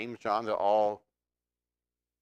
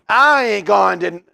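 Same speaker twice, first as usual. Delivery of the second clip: Southern Peter; 8 semitones higher, 14 dB louder than usual. Retold or imitated. Preacher voices Peter's denial in a loud Southern accent. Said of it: "well, that's Southern".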